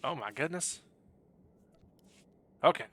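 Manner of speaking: ordinary delivery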